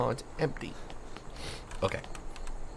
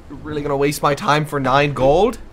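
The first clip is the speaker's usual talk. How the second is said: indignant; 3 semitones higher, 8 dB louder than usual. Real, not acted.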